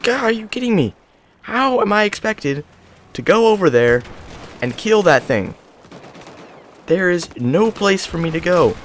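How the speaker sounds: exasperated complaint. Real, not acted. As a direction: vent at the impossible map. Trapped, no cover.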